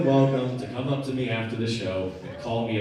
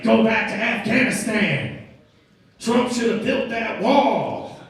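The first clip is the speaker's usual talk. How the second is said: higher, drawn-out angry shout; 6 semitones higher, 4 dB louder, slower than usual. Retold or imitated. Angry motorist at the Uber driver, mock yelling. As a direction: yell it out slowly, word by word. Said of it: "he started yelling all these racial slurs".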